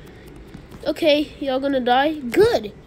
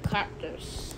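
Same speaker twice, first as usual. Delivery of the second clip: Cactus voice; 5 semitones higher, 8 dB quieter, slower than usual. Pretend. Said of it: "Why did your voice just change".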